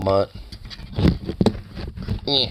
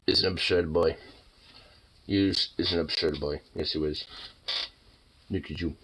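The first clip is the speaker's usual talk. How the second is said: doting pet talk; 6 semitones lower, 6 dB quieter than usual. Real, not acted. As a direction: murmur fondly to your fluffy dog. Cooing and petting the fluffy dog.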